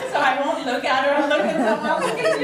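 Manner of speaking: high, loud words pushed out while laughing; real emotional laughter, close to crying; laughing through tears. Real, not acted. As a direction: laugh your way past the tears, voice lifting. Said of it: "Oh, I'm gonna cry".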